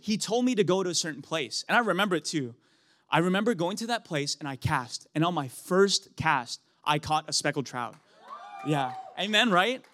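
normal talking voice

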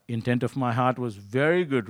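ordinary delivery

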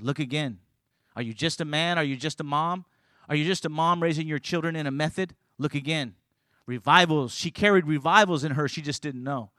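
ordinary talk

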